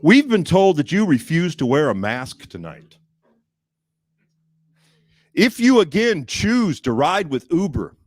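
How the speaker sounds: mock official notice voice; stern corporate email. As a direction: read it like a prim company warning. Reading Uber's email aloud.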